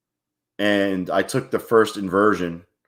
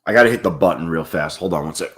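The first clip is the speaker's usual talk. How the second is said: ordinary talk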